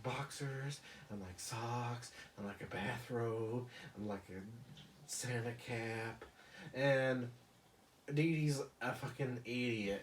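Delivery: ordinary talk, nothing marked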